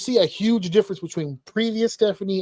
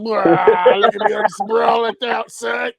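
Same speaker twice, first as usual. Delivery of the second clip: mock macho ad voice; 3 semitones higher, 6 dB louder, slower than usual. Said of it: "looking like a Sasquatch soap commercial".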